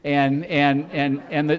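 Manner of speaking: normal talking voice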